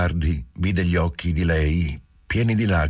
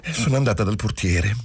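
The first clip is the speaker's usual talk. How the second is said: put-on higher young woman's voice, rushing her confession; Giulia, young wife, anxious; 7 semitones higher than usual. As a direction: pour it out, half tearful, half relieved. Giulia continues her story. (giovane moglie che confessa, voce alta e agitata)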